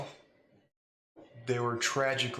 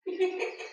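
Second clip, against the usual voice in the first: mocking laugh. Angry character breaks into laugh.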